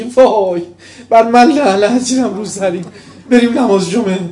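high, loud, hammed-up repentant woman, half laughing; mock pious gushing. Preacher mocking a woman's exaggerated repentance, hammed-up and loud. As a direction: overplay the sudden piety for laughs.

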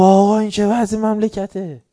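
high, angry shout at the shah. Retold or imitated. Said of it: "داد زد". (فریاد اعتراض به شاه)

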